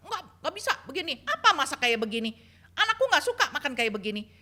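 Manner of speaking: indignant mother-in-law. Retold or imitated. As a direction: protest her son's changed loyalty. Mother-in-law quarrel retold.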